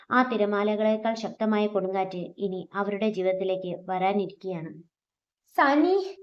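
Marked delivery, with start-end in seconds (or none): 5.6-6.2 a much higher, louder cry rising at the end; anguished scream. Acted. Adithyan screaming for the dying Saani on the beach. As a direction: scream her name across the beach. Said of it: "ആദിത്യന്റെ നിലവിളി കടൽ തീരത്ത് മുഴങ്ങി".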